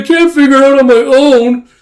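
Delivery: very high, loud whine; desperate wail. Acted. Brother begging colleagues for help, desperate wail. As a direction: wail it like a helpless plea. Yuri pleads with captains for help.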